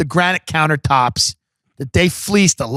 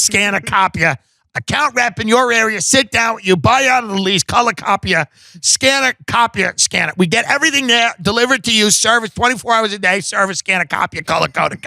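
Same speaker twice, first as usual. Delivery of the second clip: higher, relentless word barrage; Ida; 4 semitones higher than usual. Acted. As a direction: overwhelm the listener with nonstop product names. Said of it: "She would just overwhelm them with words".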